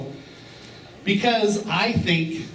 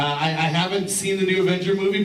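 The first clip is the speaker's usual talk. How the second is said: ordinary delivery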